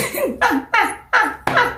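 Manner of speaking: high, stuttering laughter; amused laugh. Real, not acted. Laughing after voicing the shocked man, high laugh. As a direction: dissolve into high giggles.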